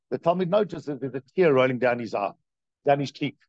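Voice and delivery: normal talking voice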